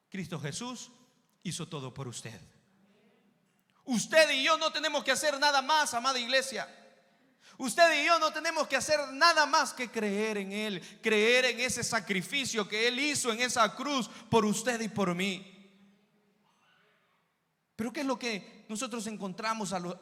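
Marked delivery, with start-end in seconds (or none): 3.9-15.5 loud, raised preaching voice; preacher in full cry; impassioned preaching. Acted. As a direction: proclaim it forcefully to the congregation.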